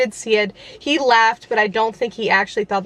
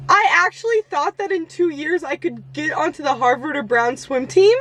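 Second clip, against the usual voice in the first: normal talking voice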